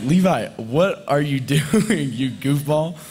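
amused, slow, laughing at a goofy friend; laughing tease. Retold or imitated. Teasing friend on the lake, laughing. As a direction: tease him through a laugh. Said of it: "And I'm like".

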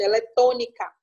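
ordinary delivery

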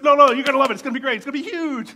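eager insisting. Retold or imitated. Lucas insisting to doubtful executives, loud and high. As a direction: talk them into it, bursting.

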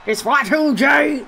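slow, shouted wrestling call; mock commentator shout; commentator catchphrase. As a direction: bellow it like a hyped ring announcer. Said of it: "what else do the good commentators".

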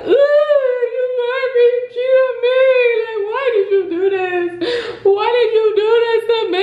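high, wailing fake sobs; mock bawling. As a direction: wail it in an exaggerated high-pitched cry. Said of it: "I was bawling, crying, like".

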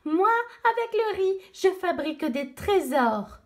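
high chattering monkey voice. Acted. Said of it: "un singe l'interpelle".

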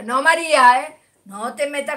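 loud playful telling-off; mock scold. Real, not acted. Teasing a viewer who mocks Boca fans, loud. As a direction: call her out, teasing.

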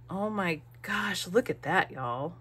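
usual speaking voice

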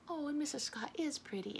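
ordinary delivery, nothing marked

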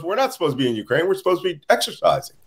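rushed, tumbling words in mock confusion; fast, flustered protest; hurried confused soldier. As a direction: blurt it out like a lost conscript. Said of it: "He goes".

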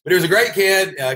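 ordinary delivery

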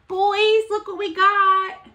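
high, loud excited call. Real, not acted. Showing the boys the package, excited calling out.

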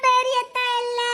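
slow, sobbing lament; tearful drawn-out wail. Acted. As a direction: bawl it out, dragging each word. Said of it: "நீ ஒப்பாரி வச்சுதான் சொல்லணுமாக்கும்".

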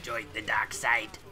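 slow, low Sith lord impression; slow, sinister tempter; Palpatine. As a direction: draw it out like a dark emperor, half-successfully. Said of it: "your Palpatine".

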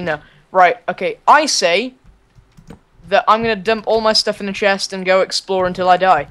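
ordinary talk

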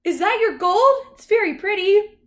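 very high, loud dog voice; high, eager talking dog; friendly dog. A narrator's reading. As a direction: admire it in a high-pitched puppyish voice. Friendly dog admires the gold.

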